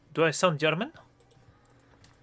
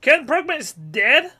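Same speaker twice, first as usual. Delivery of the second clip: shocked disbelief; 10 semitones higher, 6 dB louder, slower than usual. Real, not acted. Reading shocking news on stream.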